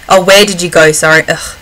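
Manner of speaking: ordinary talk, nothing marked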